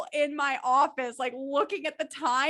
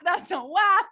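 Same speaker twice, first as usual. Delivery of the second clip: repeated, louder and punchier; louder emphatic; 5 semitones higher, 7 dB louder, slower than usual. Pretend. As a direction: belt it out the second time. Repeated song quote louder.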